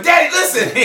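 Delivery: high, loud exasperated call; exasperated cut-off. Retold or imitated. Son cutting off his worried dad, high exasperated call.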